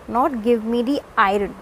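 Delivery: ordinary delivery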